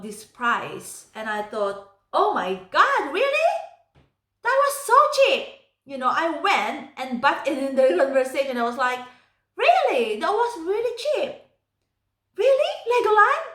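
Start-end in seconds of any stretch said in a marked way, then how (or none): 2.1-5.5 high, loud, excited surprise; amazed disbelief. Retold or imitated. Acts out her astonishment at price. 9.6-13.4 high-pitched incredulous questions; astonished questioning. Retold or imitated. Butts in, astonished at the price.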